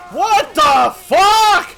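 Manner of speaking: high, slow, drawn-out scream; very loud, hammed-up horror; horrified shout. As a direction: stretch every word in loud shock. Shocked scream.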